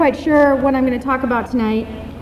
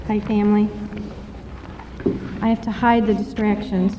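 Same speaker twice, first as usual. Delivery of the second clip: ordinary talk, nothing marked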